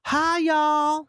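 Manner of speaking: high, drawn-out Southern greeting; Southern accent. Retold or imitated. New roommate greeting her guest at the house, high Southern accent. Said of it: "She had that Southern accent".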